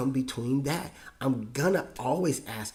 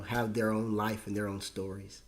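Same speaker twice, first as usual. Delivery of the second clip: ordinary talk, nothing marked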